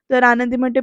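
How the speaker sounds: ordinary delivery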